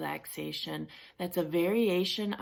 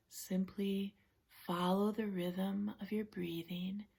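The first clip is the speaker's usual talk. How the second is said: guided relaxation; 7 dB quieter than usual. Real, not acted. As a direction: guide them gently through each breath with long pauses. Leads a relaxation session.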